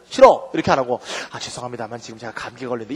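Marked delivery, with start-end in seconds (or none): 0.1-0.5 loud, higher curt no; blunt refusal. Shown as a demonstration. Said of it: "한국 사람들은". 1.3-2.9 rambling apology. Shown as a demonstration. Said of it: "구구절절히 설명하지만".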